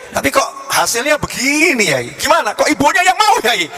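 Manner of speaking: high, hammed-up bewildered complaint; dismayed protest. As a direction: protest to the teacher, climbing very high. Pupil protests the outcome to teacher.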